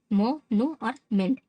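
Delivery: "मो", "नू", "मेंट" as separate slow beats; teacher counting out "मो", "नू", "मेंट"; split into syllables. Shown as a demonstration. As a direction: slowly list each piece, "मो, नू और मेंट". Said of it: "तीन हिस्से में बांटकर".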